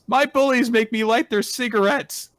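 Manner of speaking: high, loud whiny-kid imitation; squeaky loud put-on voice for a bullied kid. Voices the bullied kid's line.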